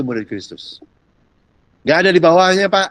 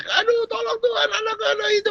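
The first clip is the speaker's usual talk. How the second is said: high-pitched imitated wail for help; mock crying out; 14 semitones higher than usual. Syrophoenician woman begging the Lord, high mock cry. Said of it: "itu yang teriak-teriak".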